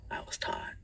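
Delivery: whispered aside. Pretend. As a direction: drop to a whisper for this bit.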